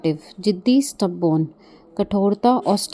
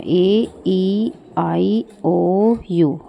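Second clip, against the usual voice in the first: each vowel drawn out and set apart; long, held letters, one by one; slow vowel recitation; much slower than usual. Shown as a demonstration. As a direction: recite the vowels slowly for learners. Teacher recites the vowels slowly.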